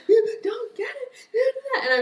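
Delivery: very high, loud wail, half laughing; hammed-up crying. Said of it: "I cried the hardest".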